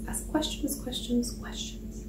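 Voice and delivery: repeated words dropping to a whisper; fading whisper. Shown as a demonstration. Telling sellers to keep asking, voice fading to a whisper.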